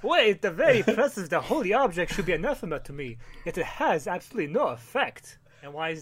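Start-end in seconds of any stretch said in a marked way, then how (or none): 0.0-3.2 theatrical vampire with swooping intonation; Dracula voice. Acted. Dracula scoffing at the holy cross, hammy vampire voice. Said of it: "you wanna be Dracula?". 3.4-5.4 softer, theatrical vampire; Dracula voice. Acted. Dracula dismissing the holy object, swooping vampire voice. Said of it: "you wanna be Dracula?".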